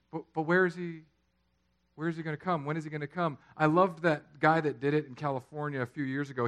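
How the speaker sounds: ordinary talk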